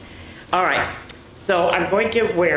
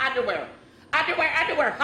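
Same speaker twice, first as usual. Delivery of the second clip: high, giddy, giggle-filled repeats; laughing; 11 semitones higher, much faster than usual. A narrator's reading. Bismarck unable to keep a straight face, laughing. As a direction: gasp the word out through laughter. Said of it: "He's laughing".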